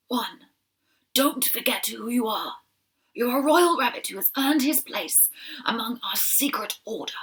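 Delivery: an aged general giving gentle orders; kindly old leader. Acted. Old leader sends Shiloh home on leave, kindly. Leader gives three important things.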